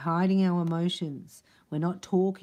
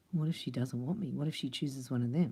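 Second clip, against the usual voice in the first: low, hushed, acted worry; mildly anxious, softened muttering; 4 semitones lower, 6 dB quieter than usual. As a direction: fret quietly under your breath. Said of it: "This is his anxiety attack".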